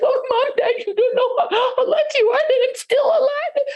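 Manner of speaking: high, panicked, tearful wail; hammed-up terrified boy gasping out his news; out of breath. A narrator's reading. Boy runs home from troll to parents, breathless and wailing. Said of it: "just was out of breath".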